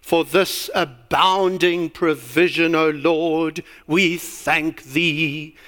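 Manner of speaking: slow, drawn-out mock-pious praying; pompous prayer. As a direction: stretch out each word like a pompous clergyman. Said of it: "he pompously prays like this".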